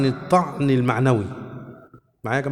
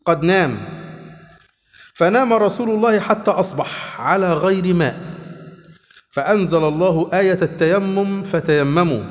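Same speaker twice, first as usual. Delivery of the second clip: slow formal Classical Arabic reading, words drawn out; hadith recitation; 3 semitones higher, 4 dB louder, slower than usual. A narrator's reading. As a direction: finish the hadith in measured, deliberate phrases. Reads the rest of hadith. (قراءة حديث متأنية بالفصحى)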